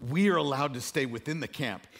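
usual speaking voice

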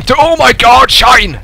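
high, loud burst of alarm; startled yell. Real, not acted. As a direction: break into a loud high cry. Sudden attack mid-sentence.

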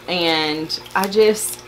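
ordinary talk

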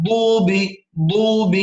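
ضوب with a heavy pressed ض, long oo; correct Dad. Shown as a demonstration. Teacher models it, ضوب with heavy ض. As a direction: press the tongue side for ضوب and hold the vowel. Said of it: "نقول".

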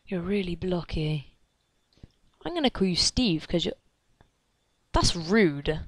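normal talking voice

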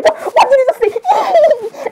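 very high, drawn-out squeal; giddy disbelief. Acted. Angel Dust character reacting to a friend, giddy disbelief. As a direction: stretch it out slowly, high and laughing. Acted overexcited reaction, laughing.

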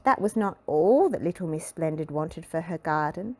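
usual speaking voice

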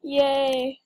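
ordinary talk, nothing marked

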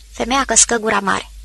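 ordinary delivery

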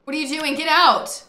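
high, loud orders to get out; urgent yelling. Real, not acted. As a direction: shout a warning at him. Urging Sim out of fire.

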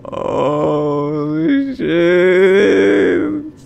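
loud, high, hugely drawn-out cry; stunned wail. Real, not acted. Player who just wrecked the car, stunned wail. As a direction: stretch each word into a long wail.

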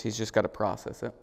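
normal talking voice

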